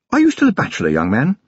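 high-pitched lady of the house, suddenly inquisitive; high opening, dropping on the address; inquiring lady. A narrator's reading. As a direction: quiz him in a high, imperious voice. Lady questions young constable.

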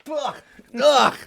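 gagging, high and loud; disgusted gag. Real, not acted. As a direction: react like you nearly gag. Gagging on baby food.

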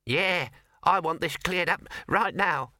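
high, slow, feisty mouse; squeaking mouse. Acted. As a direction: squeak it as an indignant little mouse. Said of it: "squeaked the mouse".

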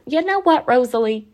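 high, playful retort to grandma; mock exasperation. Granddaughter talking back to nonna on the phone, mock exasperated. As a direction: answer back with put-on indignation.

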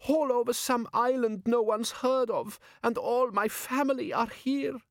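high, light woman's voice; high young woman's voice. Acted. Female character voice, high.

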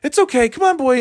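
high falsetto coaxing a dog; imagined bike owner. Pretend. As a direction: call the pet along in a silly high voice. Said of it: "He's like".

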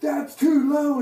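high, slow acted rebuke; insistent prophet. Prophet hitting the speaker, insistent rejection. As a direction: reject the guess, drawing out each word.

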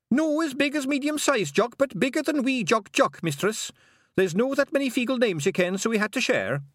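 a tiny dutiful Feagle, proud and earnest; eager pixie. A narrator's reading. Young Feagle gives his name.

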